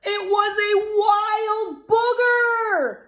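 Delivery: high, slow alarmed exclamation. A narrator's reading. Storyteller revealing the wild booger to kids, alarmed. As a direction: reveal it with wide-eyed alarm.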